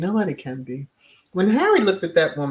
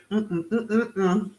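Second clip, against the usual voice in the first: ordinary talk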